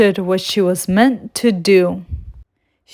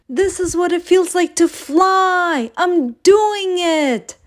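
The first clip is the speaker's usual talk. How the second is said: much higher, slowing on I'm doing it; thrilled little sleigh; proud joy; 9 semitones higher than usual. Acted. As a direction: burst with pride as you fly. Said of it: "her heart bursting with pride".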